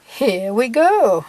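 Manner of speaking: long, slow and loud, the famous line; drawn-out loud catchphrase; her catchphrase. Pretend. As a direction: belt out the signature send-off. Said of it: "she is gonna say".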